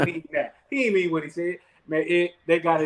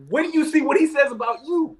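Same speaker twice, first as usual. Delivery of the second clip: agitated shout; 10 semitones higher, 4 dB louder than usual. Retold or imitated. Friend shouts during scuffle.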